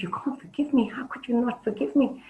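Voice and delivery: can't believe what he's hearing; shocked disbelief. Acted. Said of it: "And he was shocked".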